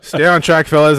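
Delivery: high, loud shout over the laughter; calling to order. Real, not acted. Storyteller to joking co-hosts after the heckle, loud call to order. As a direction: yell to rein in rowdy friends.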